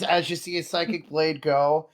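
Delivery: ordinary talk, nothing marked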